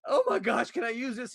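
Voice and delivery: retelling a thrilled moment; excited. Retold or imitated. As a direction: gush with delight. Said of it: "And then I'm like".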